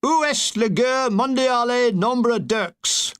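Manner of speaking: high, slow, hammed-up call in schoolboy French; a mild mock yell, carefully spelled out; mock shout in bad French. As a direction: bellow it slowly at the gendarme in pidgin French. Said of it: "I shouted".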